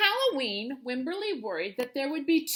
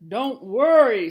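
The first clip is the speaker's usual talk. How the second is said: father; 10 dB louder, slower than usual. A narrator's reading. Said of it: "said her father".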